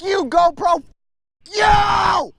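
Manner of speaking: very high, strained yelling; high-pitched real outcry; alarmed shout. Real, not acted. Alarmed shouting during mishap.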